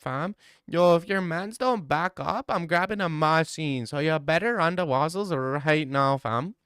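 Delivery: high-pitched, hammed-up Toronto accent; mock threats in a put-on Toronto accent. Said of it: "replace the Indian accent with Toronto accent".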